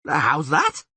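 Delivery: loud puzzled shout. Acted. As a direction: shout the question. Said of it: "shouted Dandelion".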